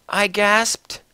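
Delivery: ordinary delivery, nothing marked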